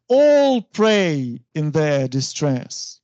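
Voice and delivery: ALL PRAY as two long heavy beats, then easing off; spondee stress. Shown as a demonstration. Teacher reciting a spondee example, two stresses in a row. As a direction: draw out and hit both ALL and PRAY, slowly. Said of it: "This is spondy".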